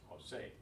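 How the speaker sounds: "José" stressed on its final syllable; stress on last syllable. Shown as a demonstration. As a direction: let the SÉ of "José" carry the stress. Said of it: "where the stress is".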